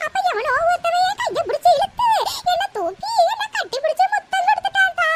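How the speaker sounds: tearful tale told in a wail; hammed-up old woman's lament; wailing and sobbing. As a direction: sob through the story, ending in a long cry. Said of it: "அலறதை நிறுத்திட்டு".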